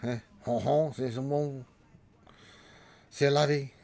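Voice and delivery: put-on tossing out French phrases in a jokey French accent; mock French. To a Canadian viewer, teasing him in a mock French accent. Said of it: "uh, Frenchy, uh, language there".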